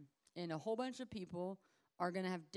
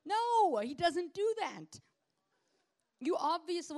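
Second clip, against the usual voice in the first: much higher and louder, slow declaration; emphatic preaching shout; 10 semitones higher, 9 dB louder, slower than usual. Real, not acted. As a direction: hammer it home from the pulpit. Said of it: "preaching emphatically".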